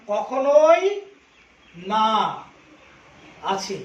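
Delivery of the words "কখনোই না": কখনোই said much louder and rising, then a pause before না; stress on কখনোই. Shown as a demonstration. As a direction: point out কখনোই with a loud rising hit. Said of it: "এখানে দেখো". (কখনোই শব্দে জোর দিয়ে)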